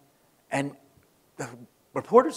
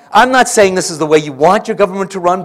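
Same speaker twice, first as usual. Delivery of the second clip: normal talking voice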